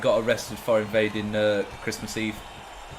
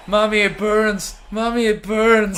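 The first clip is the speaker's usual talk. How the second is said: whiny mock cry; 9 semitones higher, 6 dB louder, slower than usual. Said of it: "he's like".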